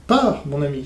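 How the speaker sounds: ordinary talk, nothing marked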